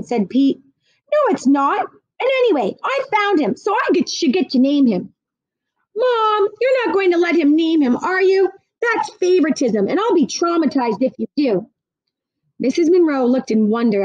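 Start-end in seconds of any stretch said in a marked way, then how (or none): 1.1-5.1 put-on whiny kid. A narrator's reading. Kid argues over name. 6.0-11.7 put-on protesting kid. A narrator's reading. Brother protests to mom.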